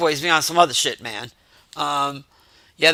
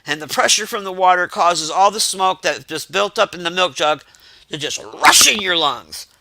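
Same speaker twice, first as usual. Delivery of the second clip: ordinary talk, nothing marked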